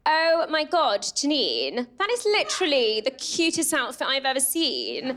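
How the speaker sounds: imitated English friend, gushing; English accent. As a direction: put on an English accent for a compliment. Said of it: "if it's in an English accent".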